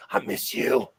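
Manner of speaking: breathy longing whisper. Pretend. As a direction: whisper it like missing a lover. Longing at Wendy's, quote.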